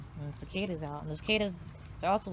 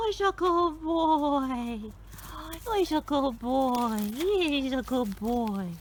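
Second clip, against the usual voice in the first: much higher, louder, slow dog praise; squeaky praising a dog; 7 semitones higher, 4 dB louder, much slower than usual. Real, not acted. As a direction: coo drawn out at the dog.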